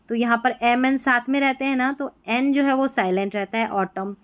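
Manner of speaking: ordinary talk, nothing marked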